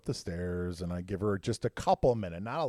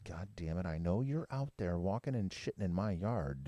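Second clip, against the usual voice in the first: lower, softer, grumpy dog; dog complaining about the yard, grumbling; 5 semitones lower, 5 dB quieter than usual. Retold or imitated. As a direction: grumble like a cranky old dog. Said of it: "he's got that grumble".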